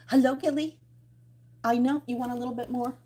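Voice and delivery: cooing to a pet fish; pet talk. Real, not acted. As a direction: coo at the fish. Talking to her pet fish.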